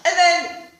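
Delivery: ordinary talk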